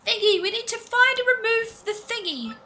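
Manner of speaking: high-pitched, chatty character voice; high cartoonish storybook voice; game hero's companion. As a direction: pitch it high and explain the plan.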